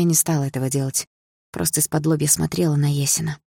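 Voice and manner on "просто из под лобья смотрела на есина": ordinary delivery, nothing marked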